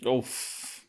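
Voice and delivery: normal talking voice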